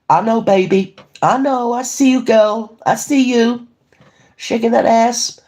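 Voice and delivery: high, drawn-out flirty teasing; mock-sultry high drawl; put-on sassy voice. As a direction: pitch it up, sassy and playful.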